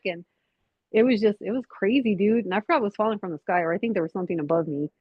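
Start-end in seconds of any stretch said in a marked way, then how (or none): none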